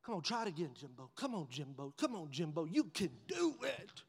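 hushed urging to the truck; coaxing whisper to truck; quiet coaxing. Retold or imitated. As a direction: softly cheer the truck on. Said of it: "I'm like".

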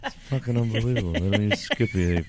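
loud put-on raving voice, rushing the swearing; Chris Italia impression. Friend mimicking the manager raving about food, hammed up loud. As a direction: hype up the food like a manic foodie. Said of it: "I can hear Chris Italia now".

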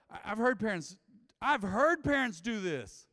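preacher's rising fervor; fervent preaching. Real, not acted. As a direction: hammer it home from the pulpit. Preaching at full force.

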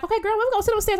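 high, chummy mock invitation; high-voiced imitation of a pushy new pal; imitated pushy stranger. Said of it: "she was like".

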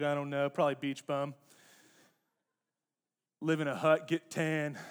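lightly voiced teen slacker; a hint of a shrugging beach bum; aimless teenager. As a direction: toss it off like a teen with no plans.

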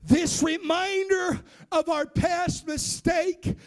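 slow, emphatic preaching declaration; full-force preaching. Real, not acted. Preacher retelling Sarah's demand that the past go, full-force preaching. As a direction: declare it, drawing out each phrase.

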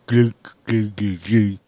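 a dull muffled clomp-clomp of dragging feet; imitated shuffling noise. Mocking office shufflers with a shuffling noise. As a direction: mimic feet dragging on a hard floor.

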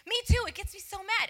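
high, loud burst of agreement; emphatic agreement. Retold or imitated. The coworker venting about her husband, emphatically. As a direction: jump in with heartfelt exasperation.

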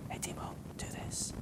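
soft breathy whisper, barely there; tiny whisper. Retold or imitated. Preacher showing God's quiet nudge, whispered. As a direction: whisper it like a still small voice. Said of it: "just a tiny whisper".

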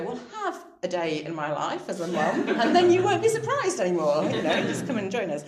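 loud, laughing mock comeback to the doctors; laughing retort. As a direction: fire it back, half joking. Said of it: "And you go".

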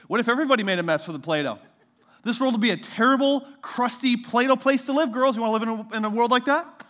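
high, loud mock lecture to the girls; mock preachy dad. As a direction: ham up the dad's moral lesson. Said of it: "and I said".

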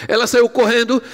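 usual speaking voice

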